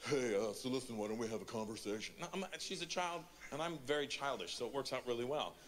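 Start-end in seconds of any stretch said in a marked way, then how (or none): 0.1-2.1 low, laid-back cool-dad voice; mock casual parent, pitched down. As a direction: imitate a dad playing it cool. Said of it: "the dad that's like".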